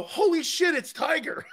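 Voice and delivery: high-pitched starstruck blurt; blurted out loud. Retold or imitated. Telling his crew how he blurted at seeing Tiger, high stunned blurt. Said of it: "I said that out loud".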